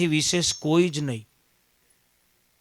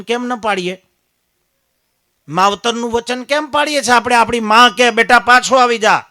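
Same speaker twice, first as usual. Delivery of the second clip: loud and higher, calling out; preaching at full force; 5 semitones higher, 10 dB louder than usual. Acted. Preacher asking the crowd why they ignore their parents, loud calling out. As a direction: cry out the mother's call to the crowd.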